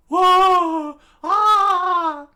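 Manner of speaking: two long falsetto screams; loud screams. A narrator's reading. Usopp and Nami scream at the slain dinosaur, shrieking. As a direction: scream at full volume, very high. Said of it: "both Usopp and Nami screamed".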